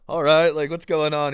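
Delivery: hammed-up confusion; mock bewildered. Teens asking the recruiter what is happening, mock puzzled. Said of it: "And you're just like".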